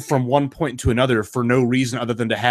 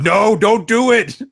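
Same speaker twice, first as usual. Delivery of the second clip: much higher, louder, slow mock plea; emphatic warning; 10 semitones higher, 5 dB louder, slower than usual. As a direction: warn her off with mock urgency. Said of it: "I said".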